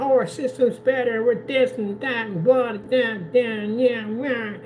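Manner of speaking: high-pitched mimicry of ranters; mock screaming rant. Gamer imitating console ranters bragging about their system, mock screaming. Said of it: "they start screaming".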